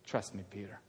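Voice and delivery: very soft and low; reassuring. Acted. Jesus reassuring a doubting Peter, gentle. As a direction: hush it, calm and sure.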